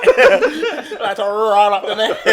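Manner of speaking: slow, loud and laughing. Real, not acted. Guest repeating his line to the others, slow and laughing. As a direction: stretch it out through laughter.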